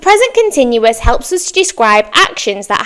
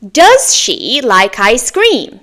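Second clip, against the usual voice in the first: slow, clear question to copy; slow model for repeating; slower than usual. Shown as a demonstration. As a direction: say "Do you speak English?" slowly for students to repeat. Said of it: "My turn".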